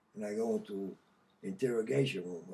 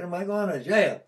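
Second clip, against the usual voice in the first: louder, higher scornful echo; mocking echo; 7 semitones higher, 7 dB louder than usual. To the probation officer, a loud mocking echo. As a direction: throws the question back, scoffing. And I laughed at him.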